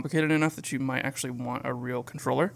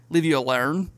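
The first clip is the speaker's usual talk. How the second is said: put-on Australian accent; 2 semitones higher, 3 dB louder than usual. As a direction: say "alone" like "alern", imitating Australians. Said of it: "every vowel has a".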